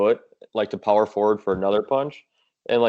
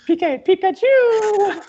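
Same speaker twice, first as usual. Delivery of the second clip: squeaky high drawn-out Pikachu cry; 4 dB louder, much slower than usual. Pretend. Teased for sounding like a Pokemon, a squeaky Pikachu cry. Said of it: "You sound like a Pokemon".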